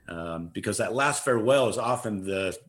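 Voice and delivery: normal talking voice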